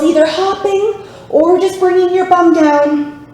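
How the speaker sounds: ordinary talk, nothing marked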